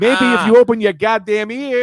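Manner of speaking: loud, high, slow mock shout; joining in the mock argument, yelling. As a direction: yell it slowly, hammed up.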